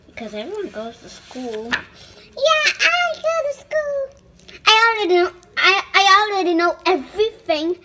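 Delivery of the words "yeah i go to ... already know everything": put-on toddler voice. Child reads pigeon character.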